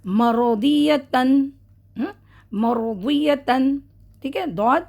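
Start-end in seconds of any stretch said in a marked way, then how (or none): 0.1-1.5 مرضیتن drawn very slowly with the tanween; Quran recitation demo. Shown as a demonstration. Teacher giving students another example, slowly. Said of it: "دوسرا ایک example". 2.5-3.8 مرضیتن drawn very slowly with the tanween; Quran recitation demo. Shown as a demonstration. Teacher giving students another example, slowly. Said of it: "دوسرا ایک example".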